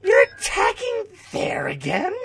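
high, strained disbelieving whine; exasperated whine. Real, not acted. Exasperated at enemy.

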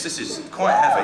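squeaky laughing remark. Real, not acted. Host lifting the pile of jokes, laughing. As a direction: laugh through the words.

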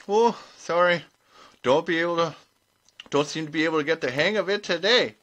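plain reading voice

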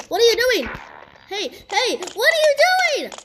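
loud, high, escalating alarm; building to a high panicked shout; alarmed yelling. Real, not acted. Alarmed at character approaching him.

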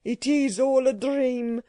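put-on accented old man protesting in dismay; cowering cry. A narrator's reading. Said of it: "cried Mister Levinstein, cowering in a doorway".

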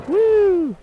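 a high whoop of delight; short high cheer; excited woo. Real, not acted. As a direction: whoop like you just won. Cheers in game.

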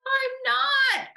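indignant little-voice squeal; high cry. A narrator's reading. Little voice denies being a teddy, crying out. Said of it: "cried the voice".

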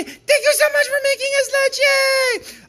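loud, bright, happy cheer; cheerful thank you. Pretend. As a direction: thank them like an excited kid. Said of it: "send it to a school and say".

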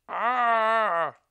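high, loud ghostly moan; long moan. A narrator's reading. Narrator voicing a mysterious noise from afar, a long moan. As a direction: wail out one drawn-out "ah". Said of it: "A long, muffled".

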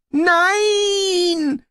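very high, long wailed cry; whimpering. A narrator's reading. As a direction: wail it out loud in panic. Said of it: "wimmerte der Gebieter". (panisch wimmernd)

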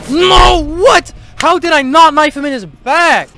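high, loud disbelief; shocked outburst. Real, not acted. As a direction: yell in total disbelief. Shocked at missed knife.

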